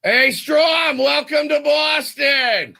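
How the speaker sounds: loud, high fan yelling across the ballpark; heckling Boston fan. Pretend. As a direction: bellow a welcome from the stands, high and slow. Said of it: "the first clip could be potentially something that you may hear at Fenway Park".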